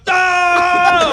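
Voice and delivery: high, loud cry of pain; pained yell. Retold or imitated. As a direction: scream as your hand is crushed. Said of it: "The guy goes".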